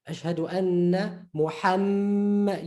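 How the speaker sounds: Ashhadu anna Muhammad slowly, N and M held; adhan emphasis. Shown as a demonstration. Teacher quoting the adhan for emphasis, held double letters. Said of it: "you hear that emphasis".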